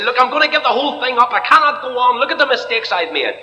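put-on despairing young person. Young person voiced giving up.

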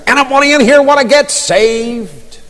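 high-pitched American evangelist's call; American accent. Retold or imitated. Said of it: "He says, in his American accent".

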